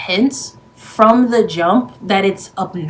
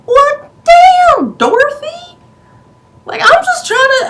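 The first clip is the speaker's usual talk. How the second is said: very high, stunned and offended; shocked squeal; 7 dB louder than usual. Retold or imitated. Paige reacting to Dorothy's attack, very high and shocked. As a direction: react like you've been slapped.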